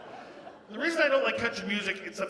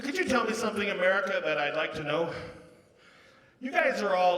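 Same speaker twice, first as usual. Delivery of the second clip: ordinary delivery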